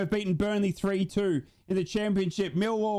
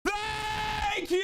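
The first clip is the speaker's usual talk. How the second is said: relieved shout; 13 semitones higher than usual. Real, not acted. Relief at the final whistle.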